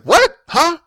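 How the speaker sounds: imitating an aggressive outburst. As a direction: bark it out in disbelief, hostile. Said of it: "All aggressive".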